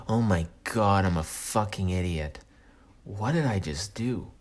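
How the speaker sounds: low, quiet dismay; subdued, lightly acted regret; mortified self-reproach. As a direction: sink into embarrassment, voice dropping. Himself, mortified afterwards.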